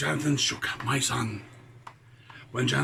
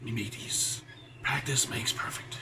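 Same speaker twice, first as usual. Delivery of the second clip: pirate voice; 3 semitones lower, 5 dB quieter than usual. Acted. Pirate host aside.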